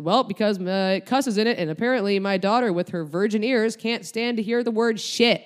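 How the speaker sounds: loud, teasing sarcasm; mock the prudish daughter; sarcastic mom. Mom mocking daughter to cashier.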